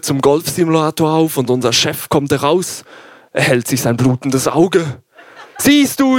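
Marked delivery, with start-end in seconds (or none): 5.6-6.2 höher und lauter, mit Lachen; triumphierend. A narrator's reading. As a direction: siegessicheres Siehst du. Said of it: "sagt diesmal ich". (triumphierend lachend)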